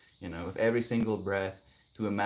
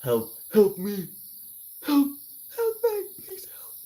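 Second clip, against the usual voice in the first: high, whimpering mock cry; hammed-up high crying plea; mock plea for help; 13 semitones higher, 6 dB louder than usual. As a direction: beg a friend, hammed-up tearful. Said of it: "Pick a friend and be like".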